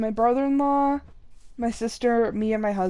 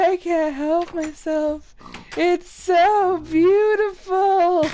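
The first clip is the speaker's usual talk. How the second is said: higher, slow dreamy mock swoon; Bug's Life quote; 7 semitones higher, 5 dB louder, much slower than usual. Quoting Bug's Life, bug drawn to light, mock swoon. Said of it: "on Bug's Life".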